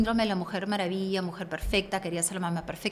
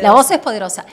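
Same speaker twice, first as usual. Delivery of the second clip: loud declaration; 14 dB louder, faster than usual. Real, not acted. Therapist proclaiming to camera, loud. Emphatic repeat of the slogan.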